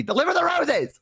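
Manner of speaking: very high-pitched comic demand; mock exasperated outburst. Caller fed up with clerk's small talk, mock exasperation. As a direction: burst out in cartoonish frustration.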